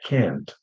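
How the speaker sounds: "can't" with the a of cat; slow American front vowel in "can't". Shown as a demonstration. Teacher showing students the American sound, front-vowel "can't". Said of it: "if you're an American".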